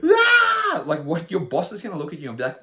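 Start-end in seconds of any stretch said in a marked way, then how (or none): 0.0-0.8 loud, high-pitched tantrum scream; mock scream. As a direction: let out a hammed-up screech. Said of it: "screaming and".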